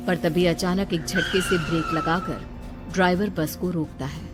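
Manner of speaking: ordinary delivery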